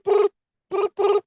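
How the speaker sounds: beeps jumping very high; silly mimicked British ringtone; imitated phone ring. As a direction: chirp it like a foreign ringtone. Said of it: "their phone goes".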